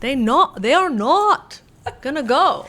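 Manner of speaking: Pennsylvania accent. Shown as a demonstration. Mimicking nephews from Pennsylvania, exaggerated accent on "not". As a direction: hammer the rounded Pennsylvania vowel in "not". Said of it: "They say".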